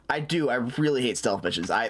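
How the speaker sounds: ordinary delivery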